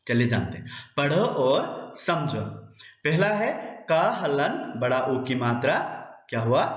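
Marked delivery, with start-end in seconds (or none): none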